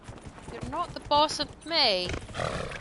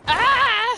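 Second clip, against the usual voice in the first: sharp high yelp of surprise; sudden high startled "Ah!"; startled cry; 11 semitones higher, 9 dB louder than usual. Real, not acted. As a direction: yelp as the horse throws you. Startled on horse dismount.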